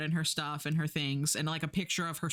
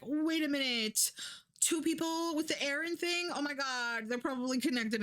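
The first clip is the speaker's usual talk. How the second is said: higher, dopey cop realizing something; higher mock detective voice; dim cop; 9 semitones higher than usual. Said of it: "the police are like".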